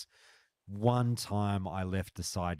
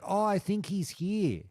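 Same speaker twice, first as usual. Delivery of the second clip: high, lightly voiced neighbour, helpful; a hint of the neighbour pointing him out; mock neighbour voice, helpful and light; neighbour's reply; 12 semitones higher than usual. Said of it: "And she's like".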